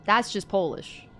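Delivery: ordinary talk, nothing marked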